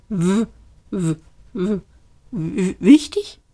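halting, repeated stutter on the first sound; stammering alarm. A narrator's reading. Arthur echoes Ford's word in alarm, stammering. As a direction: struggle to get the word out.

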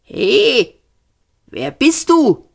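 high penguin squawk; quacking. A narrator's reading. Penguin Ford to the unseen voice, quacking. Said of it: "quakte er".

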